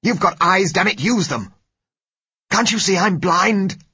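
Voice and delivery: high, loud exasperated outburst; bitter shouting. A narrator's reading. Blind doctor rages at the patient guiding him, bitter shouting. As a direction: shout it bitterly, slowing on the last question. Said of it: "he said bitterly".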